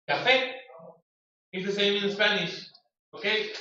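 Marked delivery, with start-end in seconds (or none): 0.1-0.5 CAFÉ with the accent on FÉ; stressed final syllable. Shown as a demonstration. Teacher showing that café has an accent, final stress. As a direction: lift the end of CAFÉ, loud and higher. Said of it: "Has an accent".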